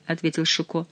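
ordinary delivery, nothing marked

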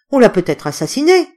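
alarmed cry. A narrator's reading. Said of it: "Mademoiselle Franchet cria".

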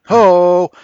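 high, loud laughing whoop. Real, not acted. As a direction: burst out with a whoop. Excited outburst after joke.

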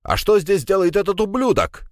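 high-pitched, outraged tipsy outburst; indignant cry. A narrator's reading. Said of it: "вскрикнул Пегасов".

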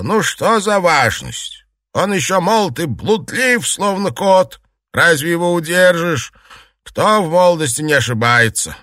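high, lively brushing-off; animated, indulgent matriarch; dismissive reassurance. A narrator's reading. Matriarch dismissively soothes the wife.

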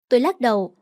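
usual speaking voice

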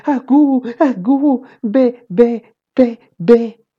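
very high pretend-baby voice; high-pitched mock infant cries; shouting baby babble. A narrator's reading. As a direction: squeal baby talk like a big kid playing baby. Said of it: "comencé a gritar".

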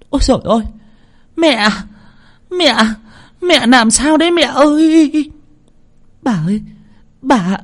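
high, shrill-pitched wails of alarm; panicked screaming. A narrator's reading. Wife and girl finding grandmother lying outside, panicked screaming. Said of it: "vang lên những tiếng hét thất thanh".

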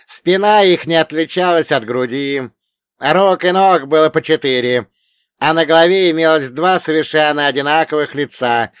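put-on loud, high old man explaining; old dead mentor. A narrator's reading. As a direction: lecture your pupil as the dead elder.

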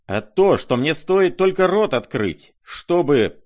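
loud, high-pitched angry outburst; yell, then break off unfinished; sudden yell. A narrator's reading. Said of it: "завопил вдруг Ганя".

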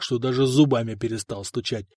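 usual speaking voice

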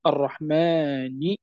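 الرحمن said slowly with its long vowel drawn out; stretched madd. Shown as a demonstration. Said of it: "كلها مدود". (الرحمن بمدّ طبيعي ممدود ببطء)

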